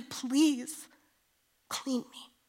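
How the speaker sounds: "Please clean me" high and slow; child-like plea to God; pleading. Retold or imitated. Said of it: "Come before him and say".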